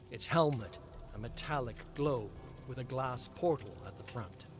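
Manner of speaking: usual speaking voice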